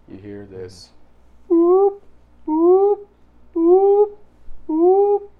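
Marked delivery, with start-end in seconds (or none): 1.5-5.3 high, loud, evenly spaced alarm boops; alarm beeps. Acted. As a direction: beep like a facility alarm. Alarm going off in the base.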